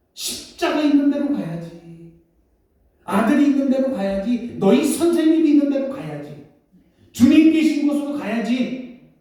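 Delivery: rally him to go now; fervent resolve. Acted. Mary resolving to go. (높고 힘차게 결연히)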